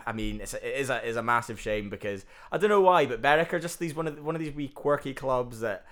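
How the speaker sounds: ordinary talk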